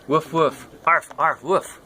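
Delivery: high yappy woofing; playful barks. Acted. Dog answering the child, high yappy barks. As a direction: bark like an eager puppy.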